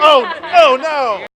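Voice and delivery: mock shout. Mock dismay at Jordan mention.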